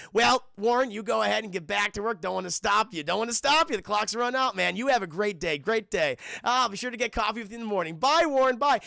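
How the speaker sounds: breezy, phony corporate bonhomie; fake friendly send-off. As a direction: shoo him out with hammed-up cheer.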